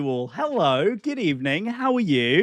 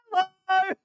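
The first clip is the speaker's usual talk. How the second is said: put-on playful gamer girl headset; 14 semitones higher than usual. High sing-song greeting to chat.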